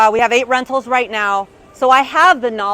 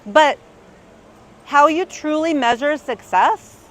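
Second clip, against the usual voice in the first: normal talking voice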